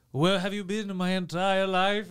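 theatrical, drawn-out mock British accent. Dater greeting his match, theatrical British accent. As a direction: declaim it slowly in a British accent. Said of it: "he does a British accent".

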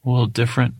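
ordinary delivery

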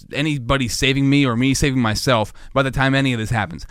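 ordinary talk, nothing marked

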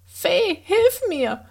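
high, urgent call to the fairy; a panicked call for help, pitched well up; cry for help. A narrator's reading. Boy calls the fairy for help as he falls, high panicked cry. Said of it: "schrie Ulrich".

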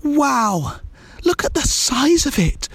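high-pitched wonder; wowed story-character voice; amazed. A narrator's reading. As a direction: exclaim it high, in awe at the sight. Amazed onlooker in story dialogue.

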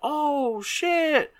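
high, stretched-out "oh shit"; real shock. Real, not acted. Telling a friend the movie twist, genuinely stunned. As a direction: let the surprise lift the voice way up.

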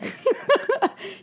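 laugh. Real, not acted. Laughing at painting a picture.

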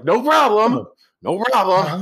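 high, projected, hammed-up reply; mock calling out. Bruce Willis forgives the helicopter guy, high mock holler.

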